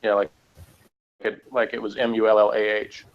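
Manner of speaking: ordinary talk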